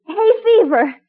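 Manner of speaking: normal talking voice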